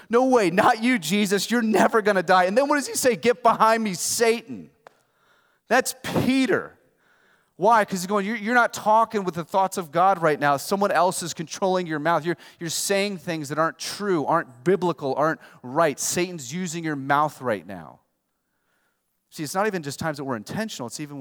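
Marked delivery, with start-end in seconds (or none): 0.1-2.4 loud, high, denying disbelief; protesting Peter. Retold or imitated. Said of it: "Peter comes and goes". 2.4-18.0 loud, impassioned sermon; preaching at full force. Acted.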